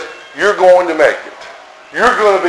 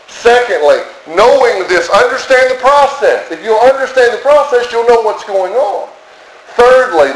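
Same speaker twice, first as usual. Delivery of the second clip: higher, driving preacher's build; preaching at full force; 6 semitones higher than usual. Acted. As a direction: urge the congregation, rising.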